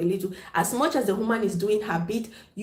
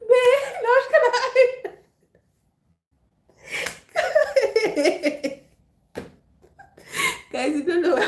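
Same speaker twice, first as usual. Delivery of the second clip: squealing high through laughter; words lost in real laughter; crying with laughter; laughing hard; 12 semitones higher, 4 dB louder than usual. Real, not acted. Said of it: "I'm laughing so much".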